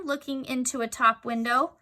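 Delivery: plain reading voice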